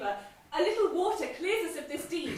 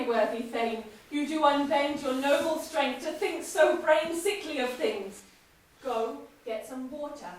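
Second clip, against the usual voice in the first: usual speaking voice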